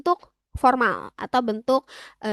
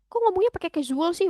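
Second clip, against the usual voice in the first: lightly acted indignant grumble; mock scolding; 6 semitones higher, faster than usual. Older Korean objecting to casual speech, mock scolding. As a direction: pretend to tell someone off, mildly. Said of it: "Udah marah-marah, ngomel-ngomel".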